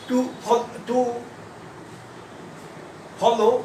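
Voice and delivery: long pauses between words, as if writing along; word-by-word dictation. Shown as a demonstration. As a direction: dictate haltingly, a word or two at a time. Writing out a demonstration sentence slowly. (শব্দ ধরে ধরে থেমে থেমে বলা)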